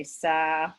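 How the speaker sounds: sail cut to sa, no final consonant at all; sail without L or N. Shown as a demonstration. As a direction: stop dead on the vowel: sa. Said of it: "a Vietnamese person might say".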